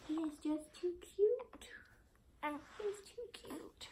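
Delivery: high whisper, drawn out on the last; high, hushed, helpless whisper; adoring whisper. Real, not acted. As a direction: whisper it, overwhelmed by cuteness. Adoring the baby.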